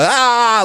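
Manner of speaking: scream. Retold or imitated. Scared scream running from church.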